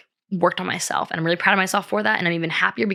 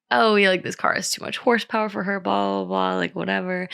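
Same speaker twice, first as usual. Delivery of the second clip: playful teasing; 2 semitones higher than usual. Retold or imitated. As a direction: lightly mimic friends poking fun. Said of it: "making fun of me, just, like, playfully".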